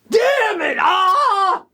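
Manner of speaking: high piercing mock scream of alarm; sudden high scream, played up; retold scream. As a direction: shriek it like she just dropped something precious. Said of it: "and she screams".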